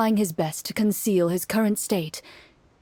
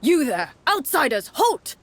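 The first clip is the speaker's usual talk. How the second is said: rising commanding call, higher at halt; 7 semitones higher than usual. Acted. Guard captain stopping outsiders, commanding call. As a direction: bark the order to stop.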